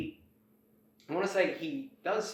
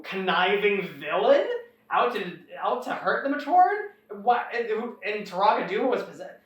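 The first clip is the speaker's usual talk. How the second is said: much higher, mocking incredulous voice; scoffing disbelief; 8 semitones higher, 4 dB louder than usual. Villain laughing off the Toa's tale, high incredulous scoff. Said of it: "Mavra just laughs it off".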